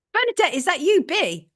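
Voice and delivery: light up as you recognise her; surprised delight. Real, not acted. Host spotting an old friend among the winners, delighted surprise.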